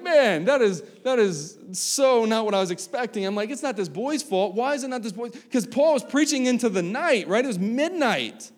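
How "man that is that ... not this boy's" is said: high, loud indignant surprise; can't believe what the commentary says; surprised disbelief. Retold or imitated.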